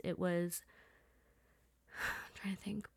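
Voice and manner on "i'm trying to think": breathy whispered aside to herself; sigh, then whisper it while thinking. Real, not acted. Sigh while searching memory.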